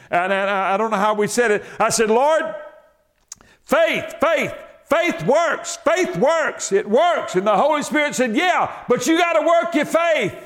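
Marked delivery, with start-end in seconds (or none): 2.1-7.4 high, slow fervent cry; fervent prayer. Retold or imitated. Preacher retelling his prayer, high and fervent. 7.4-10.3 high shouted punchline; preaching shout. Acted.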